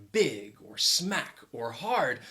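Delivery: slow, drawn-out "big", "smack", "hard"; emphatic key words. Shown as a demonstration. Teacher showing suggestive emphasis, dwelling on "big", "smack", "hard". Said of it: "if you emphasize one of those words".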